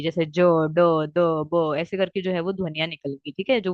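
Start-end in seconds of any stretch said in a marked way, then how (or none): 0.0-1.7 ज, ड, द, ब sounded out slowly, one by one; teacher sounding out ज ड द ब; slow letter demo. Shown as a demonstration. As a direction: spell the voiced sounds out slowly for the class. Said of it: "इसी तरीके की ध्वनि आएंगी".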